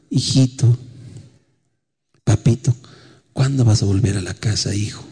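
mother gently calling her son back; tender coaxing. Retold or imitated. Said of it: "No le grité".

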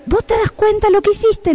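scolding mother. Retold or imitated. Mom confronting toddler over spilled talc, mock scolding. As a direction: imitate a mother confronting her child. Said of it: "entonces le digo".

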